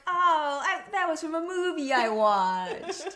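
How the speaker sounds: high, loud, laughing realization; sudden realization. Retold or imitated. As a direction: blurt out the realization. Quoting Cody realizing.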